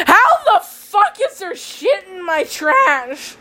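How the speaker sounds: high, slow, laughing disbelief; half-laughing, half-crying at the trash; shocked disbelief. Retold or imitated. Telling viewers, shocked laugh-cry.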